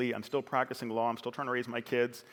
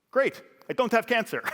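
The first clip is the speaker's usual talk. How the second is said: 高く大きく、元気いっぱいに言い切る; 病気を笑い飛ばす父の明るい声; dad's cheery defiance; 11 semitones higher, 9 dB louder than usual. Retold or imitated. Dad's defiant upbeat reply.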